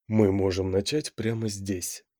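low, slow, soft seductive whisper. A narrator's reading. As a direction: whisper it into her ear. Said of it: "соблазнительно прошептал ей на ушко".

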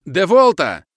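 high, loud battlefield shout. A narrator's reading. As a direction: shout the order across the field. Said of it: "кричат кубинцы". (громкий крик команды)

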